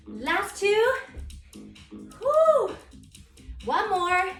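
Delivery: loud, high energetic workout cues; aerobics instructor. Real, not acted. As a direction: pump the class up, shouting encouragement. Pushing through the last reps.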